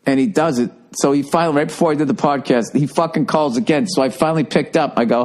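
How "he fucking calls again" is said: ordinary talk, nothing marked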